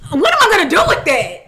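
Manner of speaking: high, loud, laughing disbelief; mock exasperation. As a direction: act baffled by an unwanted offering. Acts out baffled reaction to a hug.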